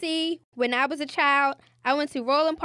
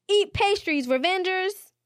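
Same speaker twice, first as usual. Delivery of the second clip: villain battle cry; 7 semitones higher than usual. Acted. Villain launches pastry attack.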